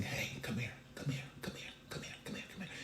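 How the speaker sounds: very soft, repeated whisper; God's whispered beckoning. Acted. God calling the listener to come close, hushed whisper. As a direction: whisper it over and over, coaxing close. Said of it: "God is saying".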